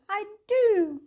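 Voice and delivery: high, loud happy cry; a gleeful little train; cried out. A narrator's reading. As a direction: cry it out with delight. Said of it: "cried Tootle".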